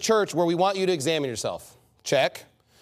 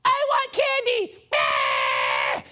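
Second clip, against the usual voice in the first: screaming tantrum; much slower than usual. Retold or imitated. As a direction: imitate a shrieking kid demanding candy. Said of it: "they're screaming, and they're crying".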